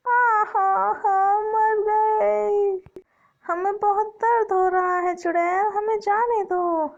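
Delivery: put-on a hurt child begging the witch to let go; child in pain. (दर्द में चीखता बच्चा, चुड़ैल से गिड़गिड़ाता)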